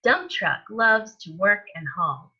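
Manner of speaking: ordinary delivery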